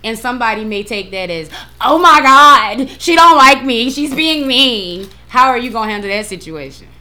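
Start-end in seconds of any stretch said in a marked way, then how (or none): 1.8-5.1 loud, higher, drawn-out dismay; dramatic offended reaction. Acted. Someone taking a simple hello as meanness, dramatically offended. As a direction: act wounded and aghast, playing it up.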